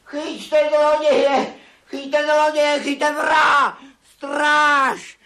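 robbed miser shouting for the guard; shouting for help. Acted. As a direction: yell it across the house.

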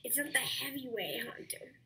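hushed correction off-mic; whispered correction. Real, not acted.

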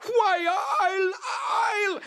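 high-pitched, drawn-out raging screech; furious creature shriek, far above the narrator's voice; screaming with rage. A narrator's reading. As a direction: screech it slowly like an enraged boggart. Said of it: "The boggart screamed with rage".

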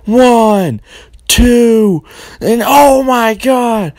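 announcer losing it at a kickout; excited pin count. Acted.

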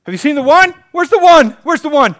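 high, loud frantic calling out. Acted. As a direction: cry out desperately for the lost one. Shepherd searching frantically.

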